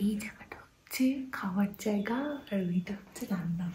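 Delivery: real whisper, guests asleep nearby. Real, not acted. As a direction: whisper so nobody wakes up. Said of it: "একটু আস্তে কথা বলছি".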